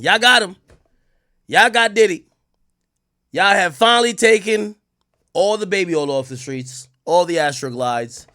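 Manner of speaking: loud, high-pitched mock triumph; sarcastic. Lawyer making fun of the prosecutors, loud sarcastic congratulations. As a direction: proclaim a fake great victory, punching each phrase. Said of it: "He was sarcastic in the closing".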